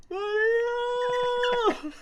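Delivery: very high, long drawn-out call; distant yelling. Retold or imitated. Storyteller imitates lost friend yelling in the distance, high drawn-out call. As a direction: imitate a far-off cry, stretching the name. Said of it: "yelling in the distance".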